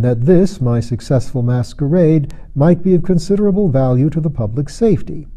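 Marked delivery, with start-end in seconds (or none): none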